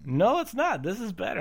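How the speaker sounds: high, loud retort; mock rebuttal. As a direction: snap back in a mock quarrel. Acted coffee quarrel rebuttal.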